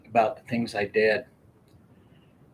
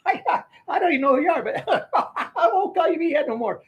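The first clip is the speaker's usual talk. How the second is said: laughing promise; 4 dB louder than usual. Retold or imitated. Promises while being beaten.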